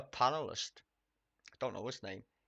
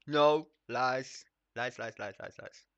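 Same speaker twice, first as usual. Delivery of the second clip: sing-song "lies"; 7 dB louder, slower than usual. Real, not acted. Streamer mocking the BBC claim with repeated lies. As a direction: chant the lies away. Chanting denial.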